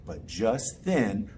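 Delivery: plain reading voice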